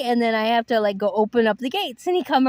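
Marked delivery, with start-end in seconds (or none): none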